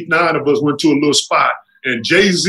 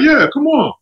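eager yes; 8 semitones higher than usual. Retold or imitated. Eager reply to commercial offer.

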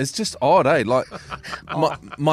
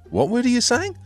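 baffled question; 12 semitones higher than usual. Retold or imitated. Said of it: "and you're like".

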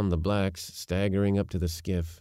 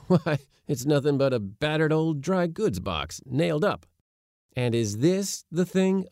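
higher, scoffing disdain; boyish disdain; 6 semitones higher than usual. A narrator's reading. Nephew mocking his uncle's invention, boyish disdain. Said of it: "without heeding my boyish disdain".

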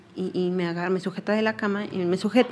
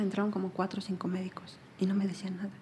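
drops into an unvoiced whisper; whispered aside; 9 dB quieter than usual. Real, not acted. Mother recalling the silent doctors, whispered. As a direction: breathe it out as a hushed whisper.

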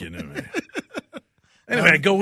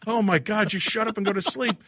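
high exasperated wife voice; 9 semitones higher than usual. Retold or imitated. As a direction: tell him off, fed up.

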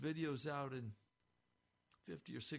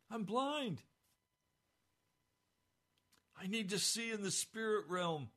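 louder, pleading cry to Jesus; loud crying out in prayer; 6 semitones higher, 5 dB louder than usual. Retold or imitated. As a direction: cry out as if you can't see. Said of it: "a loud voice crying out to the Lord".

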